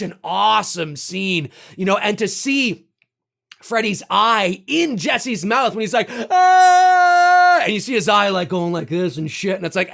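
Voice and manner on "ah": high, loud scream; mock scream. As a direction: cry out in shock. Freddy's eye in mouth.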